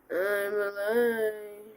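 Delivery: drawn-out goofy voice; slow silly voice. Pretend. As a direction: stretch it out, very slow. Slow put-on voice.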